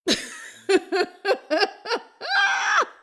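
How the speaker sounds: loud, high burst of laughter; big laugh. Real, not acted. Laughing at her own wild story, loud and high.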